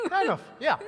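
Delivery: high, laughing reply. Real, not acted. Rabbi laughing at a congregant's guess, amused. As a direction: laugh through the answer.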